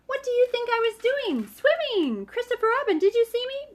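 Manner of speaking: high squeaky excited voice; excited squeak. Acted. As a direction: squeak it proudly. Roo boasting to everyone.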